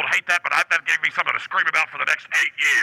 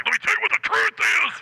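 high ranting conspiracy voice; bullhorn rant; 9 semitones higher than usual. Retold or imitated. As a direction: yell the truth at strangers. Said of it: "it was always like".

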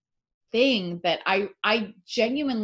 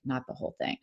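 whispered self-talk; 6 semitones lower, 8 dB quieter, much faster than usual. Retold or imitated. Answering herself over the brownie.

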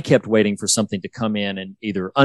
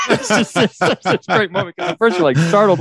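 laughing; 9 semitones higher than usual. Real, not acted. Laughing through the retelling.

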